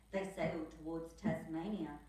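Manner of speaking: usual speaking voice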